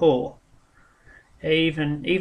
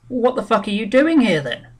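a higher, louder mock of the assessor's rude question; hammed-up blunt official, higher and louder; 7 semitones higher, 5 dB louder than usual. Assessor to claimant, a louder, higher mock of his contempt.

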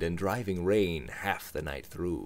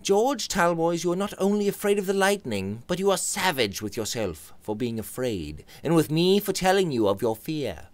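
higher, pointed friend; Robert's voice; 10 semitones higher than usual. Acted. Robert accuses George of fear, higher and pointed. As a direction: needle him, pitched up.

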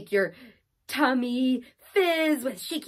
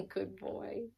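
ordinary delivery, nothing marked

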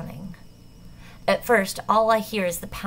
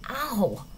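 pained yelp; 5 semitones higher than usual. Acted. Lands hard on cement.

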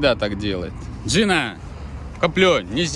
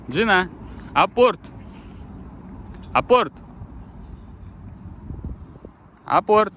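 dog command; 7 semitones higher than usual. Real, not acted. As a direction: call the dog sharply. Ordering dog to fetch.

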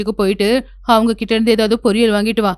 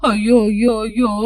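a mock wail of dismay over the lost wealth; wailing aiyo; slower than usual. Mother-in-law wailing over the lost inheritance, hammed-up lament.

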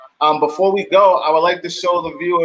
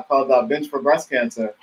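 ordinary talk

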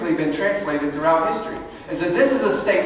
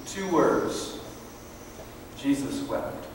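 hushed, low delivery with long pauses; solemn preaching; 4 semitones lower, 8 dB quieter than usual. Real, not acted. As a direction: let each short phrase sink in softly. Solemn scripture moment in sermon.

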